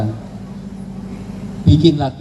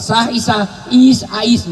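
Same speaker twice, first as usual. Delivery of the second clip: higher, teasing nickname call; a playful shortened name, pitched up; mock clipped name call; 7 semitones higher, slower than usual. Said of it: "Rasulullah nggak pernah manggil".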